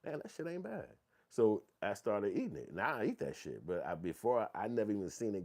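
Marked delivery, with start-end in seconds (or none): 0.0-0.9 higher, softer pleasant surprise; grudging surprise. Retold or imitated. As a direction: reluctantly admit it's good. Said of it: "and I'm like".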